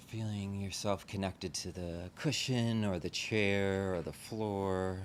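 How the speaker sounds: unhurried meditation guide, long pauses between phrases; guided meditation. Real, not acted. Teacher leading a group into relaxing the body, guided meditation. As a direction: gently talk them into settling, leaving space after each phrase.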